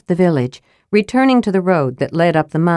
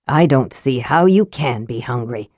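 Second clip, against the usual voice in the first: lightly voiced Raistlin, lower pitch; a hint of a disdainful mage, pitched down; Raistlin speaking; 5 semitones lower than usual. A narrator's reading. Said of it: "said Raistlin".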